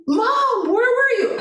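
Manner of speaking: imitating a son's high wail; distraught plea. Crying son pleads with mother.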